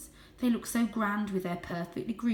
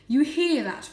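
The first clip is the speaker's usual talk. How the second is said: louder, higher proud voice; proud father; 7 semitones higher, 9 dB louder than usual. A narrator's reading. Father cat praises Julius to Varjak, proud. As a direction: show off the favourite son. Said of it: "said Father proudly".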